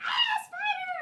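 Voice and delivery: very high fake squeal of fright; hammed-up high shriek; mock shriek. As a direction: squeal to get the boys' attention.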